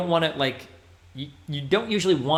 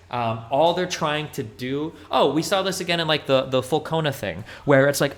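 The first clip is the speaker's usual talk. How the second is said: ordinary talk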